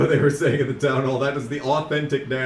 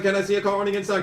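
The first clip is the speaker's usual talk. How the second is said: fast higher auctioneer patter; 4 semitones higher, much faster than usual. Retold or imitated. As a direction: rattle it off like an auctioneer. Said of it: "he sounds like a fucking auctioneer".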